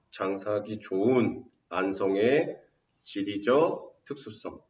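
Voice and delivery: slow, spaced out for note taking; slow dictation. Shown as a demonstration. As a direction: dictate it for the notebook. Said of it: "써놓으세요". (받아쓰기하듯 천천히)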